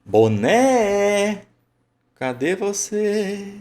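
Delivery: high, drawn-out call, final syllables held. Shown as a demonstration. Teacher acts calling a lost cap, high drawn-out call. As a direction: summon a lost thing from afar, stretching "Boné". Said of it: "Você chega e fala assim".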